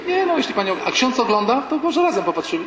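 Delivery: a lightly done eager TV-loving lady; woman fan voice. Retold or imitated. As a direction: chirp it like a chatty parishioner. Woman offers priest the TV.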